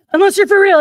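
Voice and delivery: high, loud snap of a threat; sudden menacing shout. Retold or imitated. Coworker scaring the bound man, sudden shout. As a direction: flip from hush to a yell. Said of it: "he's like".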